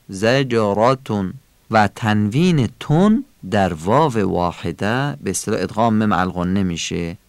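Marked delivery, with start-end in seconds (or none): 0.1-1.3 زجراتون with a thick ر and short "a"; slow, full, heavy ر in زجراتون. Shown as a demonstration.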